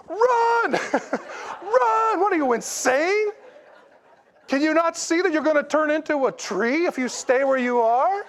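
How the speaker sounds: high, laughing mock yell of run. Pastor retelling yelling at a movie, high laughing yell. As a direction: shout at the screen, half laughing. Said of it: "you're yelling at the T V".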